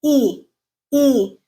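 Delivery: long, tense E repeated apart; unrounded tense E. Shown as a demonstration. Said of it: "No lip rounding".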